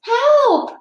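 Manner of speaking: high, loud cry for help; child's cry for help. Acted. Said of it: "cried a child off in the distance".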